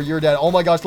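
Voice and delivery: ordinary talk, nothing marked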